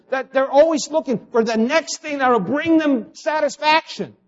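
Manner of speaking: high, driving preacher's list; impassioned preaching. Real, not acted. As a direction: pile up each item with mounting insistence. Preacher piles up a heated list.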